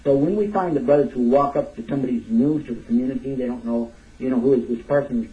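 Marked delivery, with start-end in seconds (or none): none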